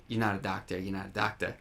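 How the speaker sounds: put-on low, soft cabbie-style New York accent; New York accent with an open final vowel on "doctor"; Jake Lockley. Host imitates the character's first lines, Brooklyn cabbie accent. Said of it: "leaves that last vowel open".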